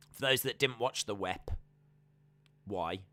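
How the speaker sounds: normal talking voice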